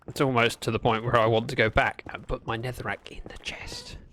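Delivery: long unvoiced musing whisper. Real, not acted. Streamer whispering thoughts while mining. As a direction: whisper the plan to yourself.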